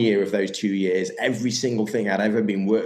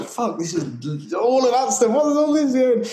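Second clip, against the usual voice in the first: imitating a bewildered person, rising in disbelief; overwhelmed bystander; 4 dB louder than usual. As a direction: play someone baffled by it all.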